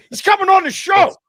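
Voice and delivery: telling wife the guest said yes; excited shout. Retold or imitated. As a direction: announce it with joy. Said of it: "I was so excited".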